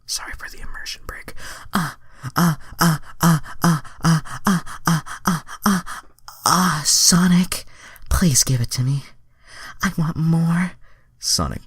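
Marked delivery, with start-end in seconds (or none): 1.7-7.7 high-pitched breathy ah-ah moaning; hammed-up high moans. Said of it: "Now I have to act out the screaming". 8.1-10.7 high, loud begging voice; Starfire pleading. Acted.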